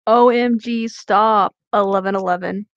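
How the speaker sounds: ordinary talk, nothing marked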